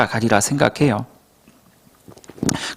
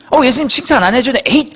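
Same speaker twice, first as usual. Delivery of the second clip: higher, petulant grumble ending in a huff; sulky huff; 9 semitones higher than usual. Retold or imitated. Rich young man sulking away from Jesus, huffing. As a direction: pout and huff off. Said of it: "그러고 돌아간 거 아니에요".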